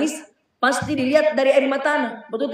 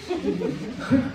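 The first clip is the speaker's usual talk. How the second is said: a short real laugh, dropping off softly; 9 dB quieter than usual. Real, not acted. Preacher laughing at his own joke, a short laugh.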